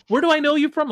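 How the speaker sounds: high, loud nosy stranger; imagined stranger. Pretend. Stranger asks the murdered friends' survivor, high and loud. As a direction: pipe up as someone half-recognizing a face.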